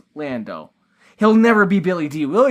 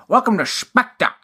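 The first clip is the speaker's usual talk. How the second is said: a hint of a Sean Connery villain; quick, lightly voiced Bond villain line; 6 semitones higher than usual. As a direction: lightly do a suave Connery-style baddie. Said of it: "Sean Connery-esque, Bond villain".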